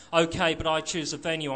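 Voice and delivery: ordinary delivery, nothing marked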